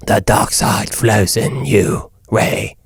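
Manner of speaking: slow, low villain line without British accent; a lame, slow dark-lord impression; Darth Sidious. Shown as a demonstration. Host showing the villain minus his accent, slow low voice. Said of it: "not a British accent".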